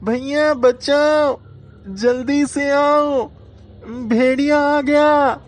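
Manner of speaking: high, slow, loud panicked cry for help; terrified shout. A narrator's reading. Boy shouting to his brother about the wolf, high, loud and panicked. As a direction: scream for your brother as the wolf appears. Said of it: "जोर से चिल्लाया".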